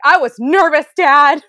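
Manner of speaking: high, loud whiny protest to dad; defensive protest. Retold or imitated. Daughter answering dad's feedback, loud defensive whine. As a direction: protest loudly to your father. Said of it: "I'm like".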